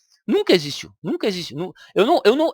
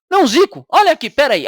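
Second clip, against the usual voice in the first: higher, louder mock exasperation; exasperated correction; 6 semitones higher, 6 dB louder, faster than usual. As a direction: correct the name again, losing patience. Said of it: "Ele falou assim". (correção irritada, mais alta e mais forte)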